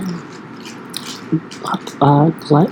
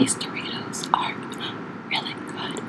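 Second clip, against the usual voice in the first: soft unvoiced whisper; 15 dB quieter than usual. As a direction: whisper it close to the mic. ASMR segment, eating chips.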